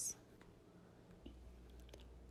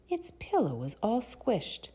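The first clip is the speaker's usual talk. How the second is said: ordinary delivery, nothing marked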